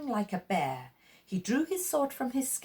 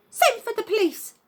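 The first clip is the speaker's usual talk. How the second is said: high, loud call for help; woman in the crowd crying for the police; alarmed cry; 13 semitones higher, 7 dB louder than usual. Acted. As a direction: shout it across the crowd. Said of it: "cried a woman in the crowd".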